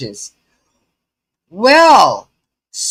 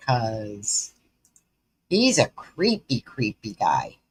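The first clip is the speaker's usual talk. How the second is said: low creepy voice; 10 semitones lower, 7 dB quieter than usual. Acted. Mocking the creepy inmate.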